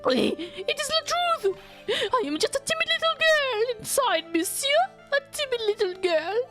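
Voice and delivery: very high, coy French chef voice; French accent. Acted. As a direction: play a timid girl in French accent. French chef, timid then defensive.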